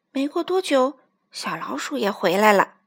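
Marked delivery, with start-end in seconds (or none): none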